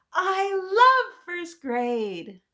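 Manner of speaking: high, loud, slow drawn-out cheer; joyful shout. Acted. Michael shouts to the whole class, joyful drawn-out cheer. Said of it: "shouted to the whole class".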